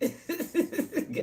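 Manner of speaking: airy wheezing laugh, high up; high breathless laugh. Real, not acted. Vlogger laughing off a rough day online, airy wheeze.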